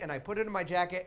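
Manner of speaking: ordinary talk, nothing marked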